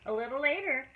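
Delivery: plain reading voice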